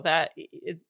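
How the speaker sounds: ordinary talk, nothing marked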